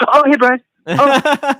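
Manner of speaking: high, bright-voiced fake friendliness; high awkward hello; awkward chirpy greeting. Acting out awkward friend encounter.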